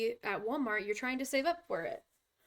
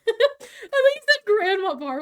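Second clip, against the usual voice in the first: laughing; 10 semitones higher, 13 dB louder than usual. Real, not acted. Laughing at misheard word.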